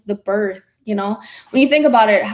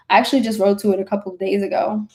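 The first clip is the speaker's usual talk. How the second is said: ordinary talk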